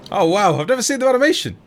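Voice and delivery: loud, high burst of delight at something new; excited. Real, not acted. Streamer thrilled to see the crab attack animation, loud and high. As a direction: yelp it out, thrilled to finally see it.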